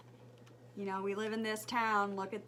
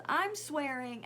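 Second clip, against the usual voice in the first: ordinary talk, nothing marked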